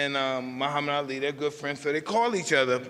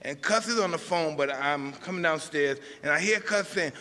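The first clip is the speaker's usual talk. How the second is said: ordinary delivery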